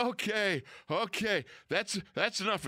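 high, hurried okay okay; startled coach backing off. Retold or imitated. Coach after the body flies at him, high and flustered. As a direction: back off fast, spooked.

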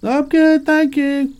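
shrill falsetto brush-off; squeaky high voice. Acted.

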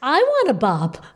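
a teasing echo of the phrase; mock eager bop. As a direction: lightly send up the eager wish. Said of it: "And I was like".

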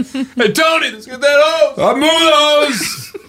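calling out. Acted. Calling out to Tony.

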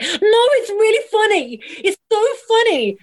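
high, whiny insistence; high mock whine; pleading protest. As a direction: beg the director like a sulky kid. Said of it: "we'd be like".

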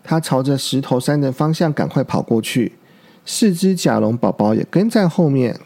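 usual speaking voice

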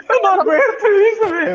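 high-pitched laughing words; can't stop laughing while talking. Real, not acted. Friends laughing at the mix-up.

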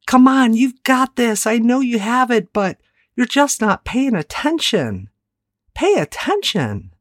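a coach pushing a distracted player; coach's voice. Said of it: "did your coach ever say something like".

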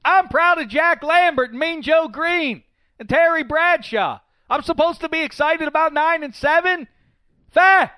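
loud, high outraged rant; indignant rant. Real, not acted. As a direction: rant like a fed-up fan. Steelers fan ranting about standards.

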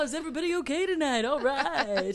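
fast, high, loud showman voice; lounge singer patter. Pretend. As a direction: work the crowd like a stage host.

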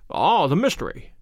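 plain reading voice

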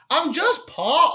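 high, slow attempt at a British accent; drawn-out, badly faked British star. Attempting an impression for the co-host, high and slow. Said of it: "this is my impression".

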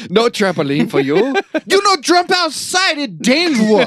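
high, loud hammed-up parent caricature; storyteller voicing parents who refuse a trampoline; mock parent accent. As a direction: scold like a strict parent, played for laughs. Mocking parent voice, retold Christmas.

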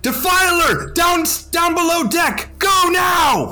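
shouting orders. Acted. Disguised player shouts orders at sailors, urgent. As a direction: bark commands like a panicked officer.

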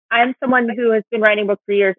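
ordinary talk

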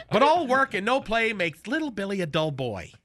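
normal talking voice

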